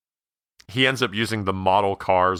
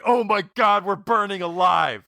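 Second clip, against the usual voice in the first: burning scream; 10 semitones higher than usual. Retold or imitated. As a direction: scream it like you're on fire. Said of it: "while going".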